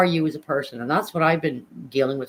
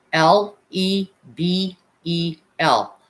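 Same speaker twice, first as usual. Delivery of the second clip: spelled out slowly; 3 semitones higher, 5 dB louder, much slower than usual. Shown as a demonstration. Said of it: "What is your name on Facebook".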